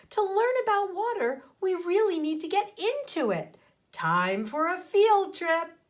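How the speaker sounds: high, loud eager teacher; enthusiastic teacher. A narrator's reading. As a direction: sell the trip with gusto. Miss Frizzle excited about trip.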